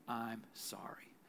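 soft slow whisper, no voice; whispered apology. Shown as a demonstration. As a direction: breathe the words out gently. Sermon models a humble apology.

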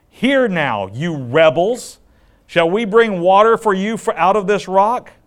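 loud, high, scolding rebuke of the rebels; angry Moses. Retold or imitated. Moses scolding the rebellious people at the rock, loud and angry. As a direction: thunder it at the crowd like a furious leader.

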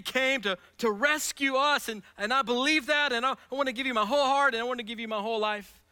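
loud, high, pleading prayer voice; fervent preaching. Acted. Pastor voicing a seeker's confession, fervent and loud. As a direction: pour it out as a heartfelt confession.

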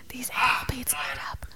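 hushed whisper. Pretend. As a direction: whisper it secretly. Whispered joke.

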